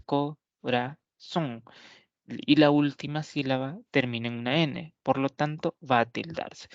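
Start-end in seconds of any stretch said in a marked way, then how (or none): 0.0-1.6 "corazón" slowly split into co, ra, zón; "corazón" in three slow, separated beats; syllable by syllable. Shown as a demonstration. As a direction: count out the syllables of "corazón" with gaps. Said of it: "tiene tres sílabas".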